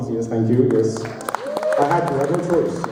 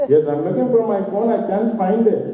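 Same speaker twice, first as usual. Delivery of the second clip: dad scolding; 6 semitones higher than usual. Retold or imitated. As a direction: shoo your grown son away like a child. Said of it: "he's like".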